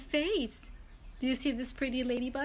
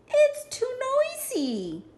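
squeaky, high and slow; high squeaky ladybug voice; 13 semitones higher, 5 dB louder, slower than usual. Acted. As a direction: complain in a tiny high bug voice. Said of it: "said the lazy ladybug".

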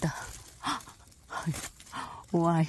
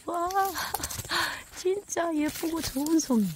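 delighted squeal; 11 semitones higher than usual. Real, not acted. Thrilled at finding a pine mushroom.

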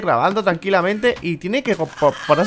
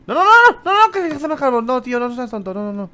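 high, loud panicked no no no; alarmed; 8 semitones higher, 6 dB louder than usual. Real, not acted. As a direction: stop it quick in alarm. Alarm at a mistake.

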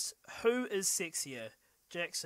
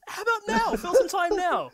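teasing shout; 10 dB louder than usual. Real, not acted. Teasing partner to fill time.

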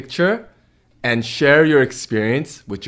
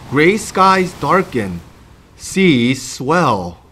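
put-on reading to children. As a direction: read it like bedtime for little ones. Reads picture book to kids.

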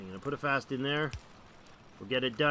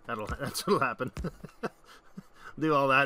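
normal talking voice

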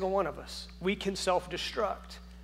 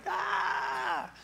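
caps lock yell; 8 semitones higher, 3 dB quieter than usual. Retold or imitated. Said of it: "caps lock on and you didn't realize".

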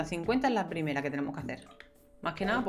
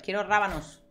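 normal talking voice

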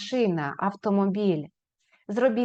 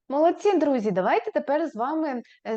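usual speaking voice

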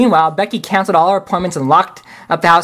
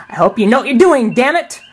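grumbling outburst; 7 semitones higher than usual. Acted. McCoy grumbles at Kirk.

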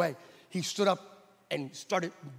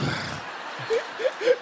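a high, breathy burst of laughter; high airy laugh; 14 semitones higher than usual. Real, not acted. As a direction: laugh up high at your own joke. Laughs at own joke.

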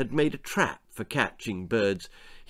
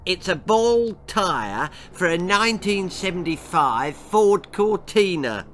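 put-on spiteful girl; 6 semitones higher than usual. A narrator's reading. Girl spoiling Baby's birthday present, gleeful spite. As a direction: drop the news with mean glee.

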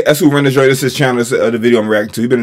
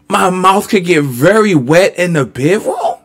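slow, higher-pitched mock echo; incredulous mimicry; 5 semitones higher, slower than usual. Host repeating contestant's fun fact, incredulous mimicry. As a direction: echo her slowly, up high, in disbelief.

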